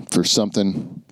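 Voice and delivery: usual speaking voice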